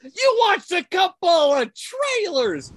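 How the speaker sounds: high, loud and slow excited echo. Real, not acted. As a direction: burst out with exaggerated excitement. Mock excited reply.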